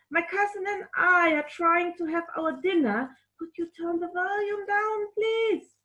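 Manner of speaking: polite request. Acted. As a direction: ask the cat politely. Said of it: "Jane replied".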